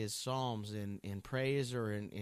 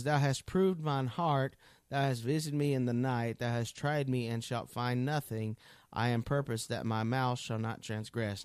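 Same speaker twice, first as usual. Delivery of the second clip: scripture reading; 4 dB louder than usual. Acted. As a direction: give each line of the psalm weight. Reads verse three aloud.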